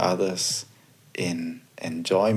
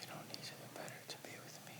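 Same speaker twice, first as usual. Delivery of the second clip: quick faint whisper; whispered echo; 28 dB quieter, much faster than usual. Shown as a demonstration. Co-host echoing the affirmation, quick faint whisper. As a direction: breathe the words back, very fast and faint. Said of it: "you can just repeat".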